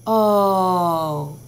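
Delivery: O said long as its name; teacher modelling long o. Shown as a demonstration. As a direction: hold the long O. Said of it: "make that letter say its name".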